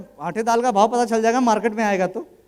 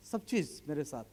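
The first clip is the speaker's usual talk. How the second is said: normal talking voice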